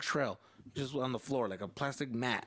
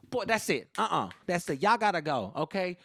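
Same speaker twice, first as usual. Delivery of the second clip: higher, louder, insistent dismissal; fed-up mom; 4 semitones higher, 4 dB louder than usual. Retold or imitated. Mom sends her son's little friends home, high and firm. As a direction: shoo everyone out as a fed-up mom.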